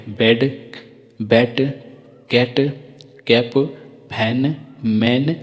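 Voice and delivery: slow, clear words with a pause after each; careful teacher drill, one word at a time; model words for repeating. Shown as a demonstration. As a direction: read them out so the class can repeat. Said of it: "मेरा साथ पढ़िए आप".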